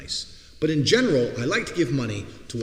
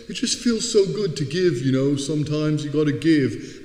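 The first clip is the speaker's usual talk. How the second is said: mock-sincere, higher voice; smug charity giver; 3 semitones higher, 4 dB louder than usual. Mocking a filmed giver's fake humble words, smug. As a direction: ham up a self-satisfied do-gooder.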